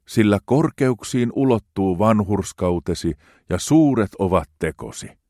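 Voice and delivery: hartaasti, rauhallisesti luettu psalmi; juhlallinen psalmin luenta. Acted. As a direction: lue kuin rukousta jumalanpalveluksessa. Reads psalm aloud solemnly. (juhlallinen raamatunluku)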